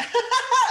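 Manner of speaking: squealing laugh, sudden joy; high burst of laughter. Retold or imitated. Nervous actor freed by the word, happy laugh. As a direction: let out a delighted shriek of a laugh. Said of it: "I was like".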